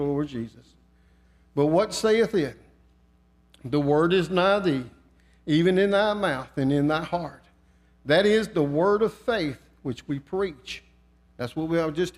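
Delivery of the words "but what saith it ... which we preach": scripture reading. Acted. Reads scripture in sermon.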